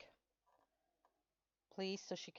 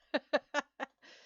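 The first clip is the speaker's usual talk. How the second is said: laugh; 9 semitones higher, 7 dB louder than usual. Real, not acted. Laughing at own accent remark.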